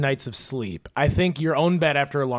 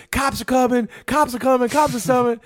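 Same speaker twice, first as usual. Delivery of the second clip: high singsong alarm call; mock warning cry; 7 semitones higher than usual. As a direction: repeat it as a high imitated street warning. Imitates overheard warning cries.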